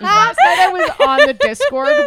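cutting in over friends laughing, calling out loud. Real, not acted. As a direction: shout to get the story back. Talking over laughter to be heard.

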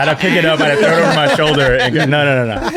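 loud, high joking over laughter; joking through laughter. Pretend.

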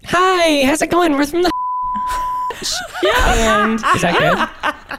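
put-on college girl voice. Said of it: "try to talk like a college girl".

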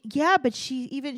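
usual speaking voice

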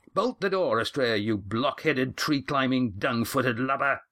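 high, exasperated yelled order; exasperated shout. Acted. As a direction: bellow the insult in frustration. Mirac calls back through door.

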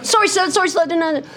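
high, pleading mock apology to a teacher; cowering schoolkid. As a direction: squeal it like a scolded pupil. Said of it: "And you go".

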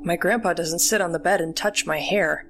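ordinary delivery

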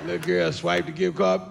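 ordinary talk

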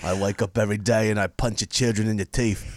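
Jason Statham impression. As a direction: menace in a mock London hard-man accent. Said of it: "'cause I'm Jason Statham".

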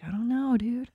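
whispered helpless reply; barely voiced, quoting someone clueless; soft, whispery aside; hushed retold shrug. Quoting the PT's helpless answer.

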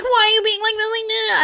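very high clingy-friend imitation; mock whining. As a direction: whine in protest like a drunk friend. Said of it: "biggest pet peeves in the entire world is whiny tone".

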